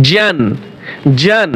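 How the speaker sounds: ordinary talk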